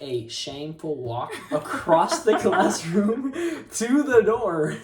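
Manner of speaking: loud, high, breaking into laughter; laughing emphasis. Real, not acted. Telling listeners about the shameful walk, laughing loudly.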